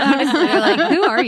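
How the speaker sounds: high laughing words through giggles; amused laughing disbelief. Real, not acted. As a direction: laugh while asking who she is. Laughing at friend's impression.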